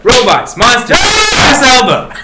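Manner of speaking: mock-triumphant, rising to a high, loud shout; gleeful building shout. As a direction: build from loud boast to drawn-out yell. Gleefully spoiling movies, building to shouts.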